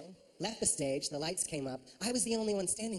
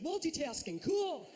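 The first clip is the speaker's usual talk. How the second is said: meek fake approval; 6 semitones higher than usual. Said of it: "I'm just like".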